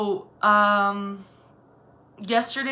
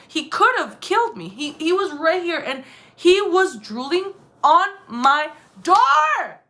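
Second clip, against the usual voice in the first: high, loud, building to a drawn-out yell; agitated outburst; 7 semitones higher, 7 dB louder than usual. Retold or imitated. Woman retelling how close the stranger came, agitated outburst. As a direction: get more worked up until the last word bursts out.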